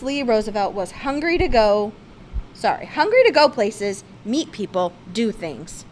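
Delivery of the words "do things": plain reading voice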